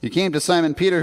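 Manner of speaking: usual speaking voice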